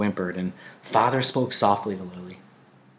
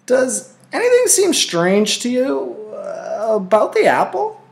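put-on higher, louder young boy calling across the field; a puzzled boy calling to his friend; boyish call; 14 semitones higher, 5 dB louder than usual. A narrator's reading. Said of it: "he had called".

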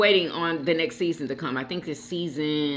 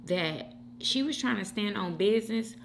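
normal talking voice

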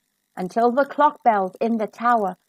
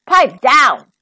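shouting; 8 dB louder than usual. Acted. Stepmom shouts at Cinderella in the cellar, loud. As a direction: shut her up from upstairs. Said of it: "shouts Mom to Cinderella".

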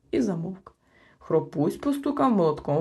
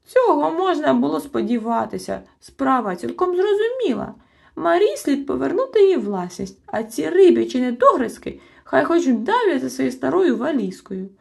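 higher, louder indignant tirade; scornful outburst; 7 semitones higher, 7 dB louder than usual. A narrator's reading. Chmykh scorns the defendants, loud indignant. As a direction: sneer at the little ones. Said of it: "чмихнув Чмих".

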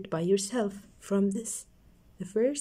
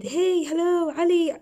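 cheerful call; 8 semitones higher, 5 dB louder than usual. Acted. Teacher voicing storybook greeting.

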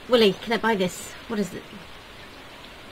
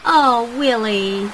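drawn-out lovestruck sigh, louder; swooning sigh; 5 dB louder, much slower than usual. Pretend. Player swooning over Willy, a long dreamy sigh. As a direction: sigh his name like a smitten admirer.

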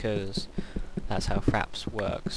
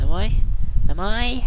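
teasing rising questions, much higher each time; eager hope; 10 semitones higher, 10 dB louder than usual. Real, not acted. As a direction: egg yourself on, climbing up. Hoping for more charcoal.

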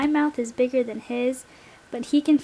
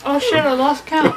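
laughing while talking; words breaking into laughter; 6 dB louder than usual. Real, not acted. Laughing mid-game.

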